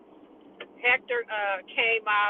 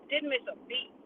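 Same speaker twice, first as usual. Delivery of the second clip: usual speaking voice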